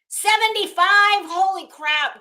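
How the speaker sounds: high, loud, slow shock at the thermostat; shocked exclamation. Real, not acted. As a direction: blurt it out in disbelief. Shocked by the office temperature.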